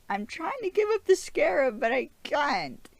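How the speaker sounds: high, loud, desperate protest; Stephen. Acted. Vlogger as Stephen answering the voice, high frantic wail. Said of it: "And Stephen's like".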